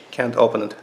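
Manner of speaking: ordinary talk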